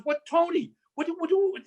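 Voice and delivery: high, mocking disbelief; incredulous mock. [name] mocking Tony's excuse, high incredulous repeats. Friend mocks his answer.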